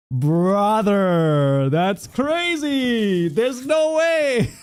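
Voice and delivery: high, slow, stunned shout of joy; overwhelmed gasping delight; excited disbelief. Real, not acted. Opening an amazing gift.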